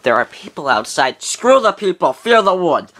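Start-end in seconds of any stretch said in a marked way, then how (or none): 1.2-2.9 defiant. Acted. Defiant reply to warning.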